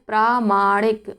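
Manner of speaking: प्रामाणिक said slowly, long प्रा stretched; slow correct form. Shown as a demonstration. Teacher correcting प्रमाणिक to प्रामाणिक, slow, long प्रा. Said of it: "गलत है, क्या होता है?".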